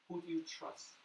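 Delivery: normal talking voice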